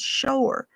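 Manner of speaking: "sure" said as "shoo-er" in the mountain way; local pronunciation. Shown as a demonstration. The boy playing Zeke insisting on the local sure. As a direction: stretch "sure" into two beats. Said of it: "That's not how you say it around here".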